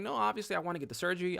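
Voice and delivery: high-pitched insisting; urgent protest. Retold or imitated. Patient assuring the specialist he wants surgery, urgently protesting.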